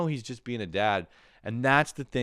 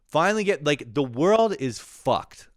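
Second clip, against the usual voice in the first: louder rant; 5 semitones higher, 5 dB louder than usual. Real, not acted. Ranting about the state of things.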